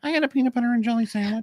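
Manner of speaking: high, fast teasing imitation of her answer; mock co-host. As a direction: parrot her words back in a squeaky mocking voice. Mocking co-host's lunch answer.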